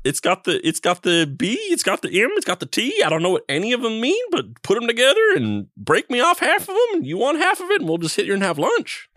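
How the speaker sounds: high-pitched chatty alien; rambling friendly alien. Acted. Alien keeps rambling about sandwich.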